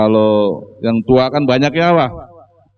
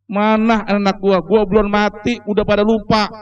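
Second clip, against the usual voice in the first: put-on higher, reproachful old man voice; complaining old man; 6 semitones higher than usual. Dead haji scolding forgetful children, higher reproachful voice. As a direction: reproach the children who forgot him. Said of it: "begitu, Pak".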